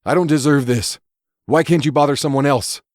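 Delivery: high, pleading voice near tears; tearful plea. Acted. Derek pleading tearfully with the costumed man, high and pleading. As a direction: beg him to leave you alone. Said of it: "Tears prickled at the edges of Derek's eyes".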